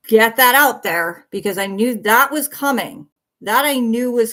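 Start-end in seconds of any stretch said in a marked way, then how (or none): 0.1-1.3 forceful declaration. Real, not acted. Defiant declaration to chat.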